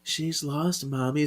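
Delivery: put-on a small girl telling mommy how sad it is; sad little girl. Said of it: "we see P J, she's like".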